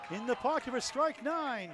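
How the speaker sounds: slow excited play-by-play call. Real, not acted. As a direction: sell the big hit in the pocket. Play-by-play of a big ball.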